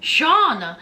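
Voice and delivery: usual speaking voice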